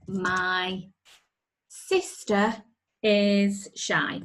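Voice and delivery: My sister is shy spoken word by word; dictating slowly. Shown as a demonstration. Teacher dictating a sentence while writing. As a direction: dictate each word as you type. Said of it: "Here we go".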